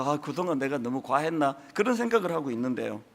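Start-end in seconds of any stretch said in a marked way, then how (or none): 0.0-1.6 lower, softer musing to himself; a private second thought, half under the breath; quiet self-doubt. Retold or imitated. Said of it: "제가". (낮고 작게 혼잣말하듯)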